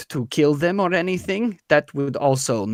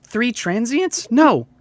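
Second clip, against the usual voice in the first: higher-pitched mild protest; lightly voiced townswoman, dismissive; 7 semitones higher than usual. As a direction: explain it away as nothing odd. GM as Treese, dismissing the question.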